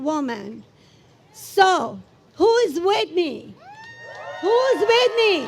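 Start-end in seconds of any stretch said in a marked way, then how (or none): 4.4-5.5 higher-pitched rallying cry to the crowd; rousing shout. Real, not acted. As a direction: call it out to fire up supporters. Repeated rallying call to crowd.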